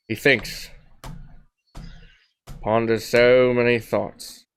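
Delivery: hushed, drawn-out narrator with long pauses; mock documentary narration. Host narrates his co-host thinking, mock-solemn narration.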